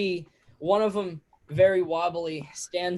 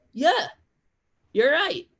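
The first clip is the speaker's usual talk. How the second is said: high, louder tavern patron; 9 semitones higher, 5 dB louder than usual. Acted. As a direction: squeak out agreement as a drunk. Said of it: "the other one goes".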